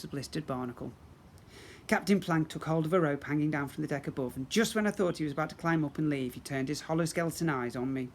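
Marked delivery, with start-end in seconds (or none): none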